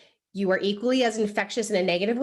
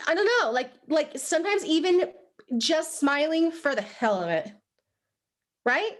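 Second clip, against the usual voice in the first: normal talking voice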